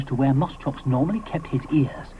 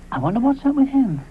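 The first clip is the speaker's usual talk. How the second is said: higher, wondering voice; puzzled Moschops; 10 semitones higher than usual. Acted. Moschops wonders about Ally, high puzzled voice. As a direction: muse aloud, puzzled.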